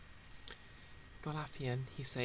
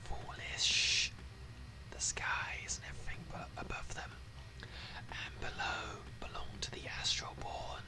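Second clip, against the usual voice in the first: slow, menacing whisper; threatening whispered voice of a sinister fanatic; eerie whispering zealot; 4 semitones lower, slower than usual. Acted. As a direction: hiss out a doom-laden prophecy. GM voices an ominous cultist.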